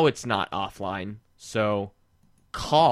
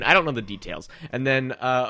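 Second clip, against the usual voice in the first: ordinary delivery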